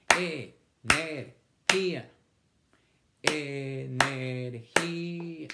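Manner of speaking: energía split slowly into e-ner-gí-a; syllable by syllable. Shown as a demonstration. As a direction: count the beats of energía slowly. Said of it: "¿Cuántas sílabas?".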